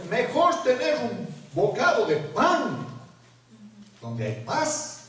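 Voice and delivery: slow, drawn-out reading of the verse; scripture reading. Acted. Preacher reading a proverb to the congregation, slow and solemn. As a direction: lift each word of the proverb.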